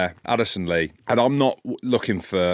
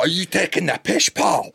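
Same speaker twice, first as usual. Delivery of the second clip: high-pitched playful ribbing, rising; joking; 12 semitones higher, 4 dB louder than usual. Retold or imitated. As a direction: tease a mate with a squeaky question. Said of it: "as in, in a joke".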